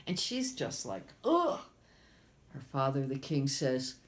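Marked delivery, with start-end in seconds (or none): 1.2-1.6 high, loud squeal of disgust; disgusted ugh. Acted. Princess groaning in disgust at the frog. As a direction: recoil with a shrill ugh.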